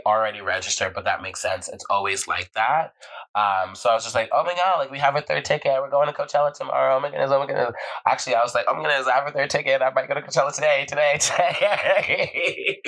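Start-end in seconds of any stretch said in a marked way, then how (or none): 4.3-7.7 higher-pitched retold excitement; thrilled. Acts out getting third ticket. 8.7-12.8 giddy excitement. Retold or imitated. Acts out ticket excitement.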